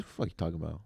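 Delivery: ordinary talk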